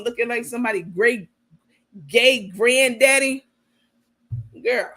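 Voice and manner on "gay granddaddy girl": usual speaking voice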